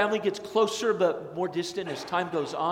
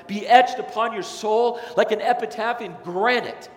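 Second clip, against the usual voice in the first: louder, higher, driving preacher cadence; preaching; 6 semitones higher, 7 dB louder than usual. Acted. Pastor contrasting sin and Jesus for the congregation, forceful preaching. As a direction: thunder it from the pulpit.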